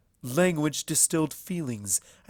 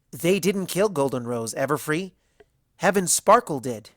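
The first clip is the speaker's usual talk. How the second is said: quick, rising, louder correction; blurting correction; 3 semitones higher, 5 dB louder than usual. A narrator's reading. Twilight corrects Everfree on history, blurting it out. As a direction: blurt it out like an eager student. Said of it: "blurting out a correction".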